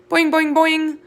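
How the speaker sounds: high, loud springy boings; boing like a worried frog. Acted. Og the frog answers the hamster with loud high boings.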